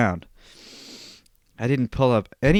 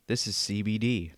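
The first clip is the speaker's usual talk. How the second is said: soft, low murmur; quiet and low; 3 semitones lower, 8 dB quieter than usual. Shown as a demonstration. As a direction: say it quietly, almost to yourself. Two takes of same line.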